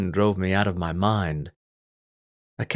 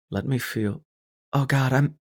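higher woman's voice, breaking off; gasping; 5 semitones higher than usual. A narrator's reading. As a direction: gasp the words out. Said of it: "she gasped".

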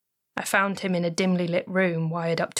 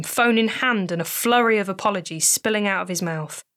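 ordinary delivery, nothing marked

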